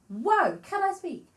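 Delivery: high, loud surprised outburst; surprised at self. Real, not acted. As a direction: exclaim at your own slip. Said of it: "I have a lisp".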